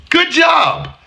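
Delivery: high mock-cheerful good job; sarcastic praise. Real, not acted. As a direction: sneer it as fake praise.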